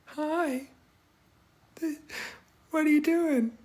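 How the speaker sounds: very high mock Valley Girl; badly faked Valley Girl squeak; Valley Girl accent. Streamer attempting the accent on request, high mock Valley Girl. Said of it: "I should be able to do a Valley Girl accent".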